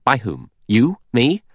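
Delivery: sharp. A narrator's reading. Lombard throws the suspicion back at Blore, sharp. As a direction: challenge him with pointed, rising questions. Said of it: "Lombard said sharply".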